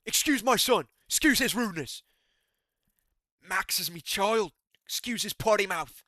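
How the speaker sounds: a cocky Irish brogue apologising for his son; McGregor impression. The fighter apologising for his son, in mock Irish accent. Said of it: "I can't really do a McGregor anymore".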